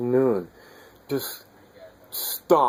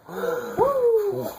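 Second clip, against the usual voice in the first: high startled gasp of amazement; sharp gasp. Retold or imitated. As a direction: gasp in sudden wonder. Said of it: "and you go".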